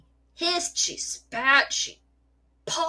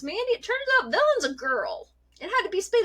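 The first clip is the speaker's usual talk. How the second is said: amused vet; 9 semitones higher than usual. Retold or imitated. Vet explains, laughing.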